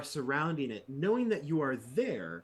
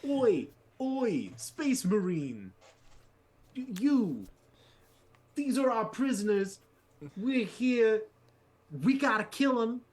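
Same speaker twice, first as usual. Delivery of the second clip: rough thug voice; 8 semitones higher, 5 dB louder than usual. Acted. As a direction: yell across to the marine like a rowdy goon. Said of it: "I don't know why they sound like that".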